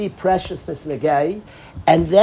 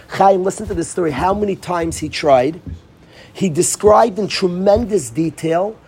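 ordinary talk

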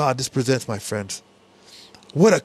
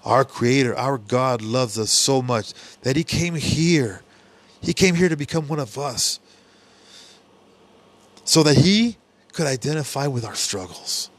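ordinary delivery

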